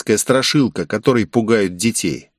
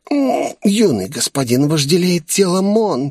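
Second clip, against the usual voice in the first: seductive purr; 8 semitones higher than usual.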